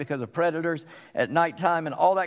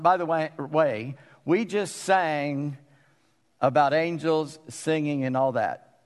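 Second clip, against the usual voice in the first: normal talking voice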